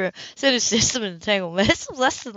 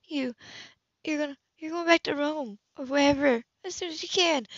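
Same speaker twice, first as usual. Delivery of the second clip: flustered stammer; 7 semitones higher, 4 dB quieter than usual. Acted. Flustered woman.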